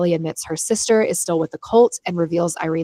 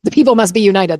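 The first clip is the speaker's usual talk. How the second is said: mock rallying cry; 8 dB louder than usual. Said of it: "I don't know why I'm like".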